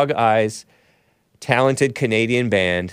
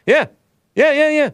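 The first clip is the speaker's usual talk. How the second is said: impatient high yeah; 4 dB louder than usual. Real, not acted. Impatient agreement.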